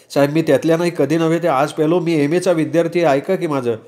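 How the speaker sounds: high-pitched pleading with an officer. Acted. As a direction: beg the officer to listen. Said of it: "मी गयावया करायला लागलो".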